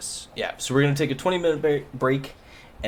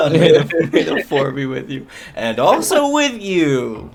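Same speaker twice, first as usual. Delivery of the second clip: laughing mock liturgy; 5 semitones higher, 8 dB louder than usual. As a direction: chant it like a congregation, cracking up. Mock church response, laughing.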